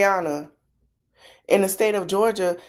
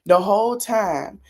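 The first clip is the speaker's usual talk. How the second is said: ordinary talk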